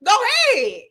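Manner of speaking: high-pitched, defiant retort; reenacted sassy dismissal; sassy comeback. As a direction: throw it at them with attitude. Quoting own defiant comeback.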